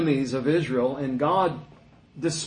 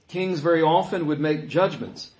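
usual speaking voice

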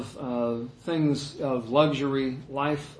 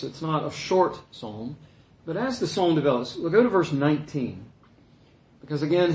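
usual speaking voice

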